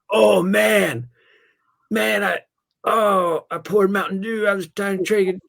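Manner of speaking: high, frantic mock-panic voice, near tears; faked panic. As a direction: fake a panicked kid covering up an accident. Faking panic to buddy, covering.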